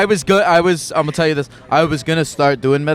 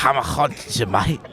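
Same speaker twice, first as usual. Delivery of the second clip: a second, softer go at the Scouse ghost; quieter Scouse attempt; 2 semitones lower, 5 dB quieter than usual. Retrying the Scouse ghost for Poppy, softer mock accent. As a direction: mutter the Liverpool threat half-apologetically. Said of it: "Sorry, sorry, sorry".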